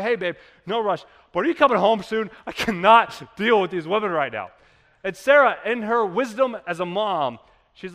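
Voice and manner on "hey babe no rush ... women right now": frazzled text. Retold or imitated. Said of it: "I text her, I'm like".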